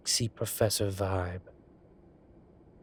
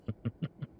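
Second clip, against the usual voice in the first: soft chuckle; 2 semitones higher, 7 dB quieter than usual. Acted. After innuendo.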